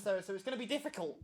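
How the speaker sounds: ordinary delivery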